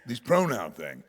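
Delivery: ordinary delivery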